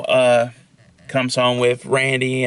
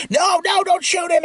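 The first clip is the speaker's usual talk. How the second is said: pleading cry. Retold or imitated. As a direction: beg him to stop, voice jumping high. Randy begging Billy not to shoot.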